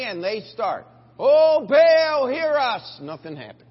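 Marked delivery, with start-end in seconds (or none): 1.2-3.0 loud, high hammed-up plea; a hammed-up loud cry to Baal; mock pleading cry. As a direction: wail to the idol. Prophets crying to Baal.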